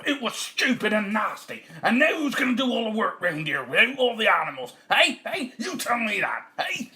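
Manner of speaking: high, loud angry tirade; scolding wife. Acted. Wife berates farmer over lost animals, loud. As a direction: berate him and demand an answer.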